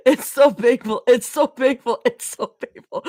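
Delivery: helpless laughing repeats; giggling too hard to speak. Real, not acted. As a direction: squeeze the words out through laughter. Laughing fit repeating a line.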